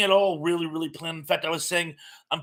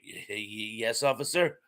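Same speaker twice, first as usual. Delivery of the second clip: stammering, much softer and lower; nervous driver; 6 semitones lower, 5 dB quieter than usual. Retold or imitated. Driver stammering back at the shouting cop. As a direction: cower and stutter before the cop. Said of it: "what is the old joke from".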